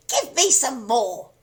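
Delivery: screechy crone. A narrator's reading. Grandma demanding more.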